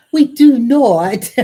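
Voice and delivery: loud, drawn-out protest; playfully scandalized denial; mock-indignant denial. Mock denial of threesome rumour.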